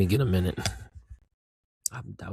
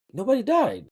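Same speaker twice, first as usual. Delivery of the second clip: much higher, emphatic disbelief; incredulous exclamation; 11 semitones higher, 4 dB louder than usual. Real, not acted. Viewer who expected a main character death, incredulous exclamation. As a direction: pitch it way up, astonished at the point.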